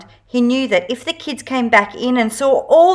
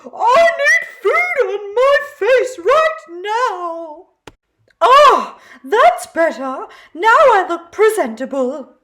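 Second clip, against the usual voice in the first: high, slow, demanding squeal then satisfied sigh; a needy paper plate insisting on food; 11 semitones higher, slower than usual. A narrator's reading.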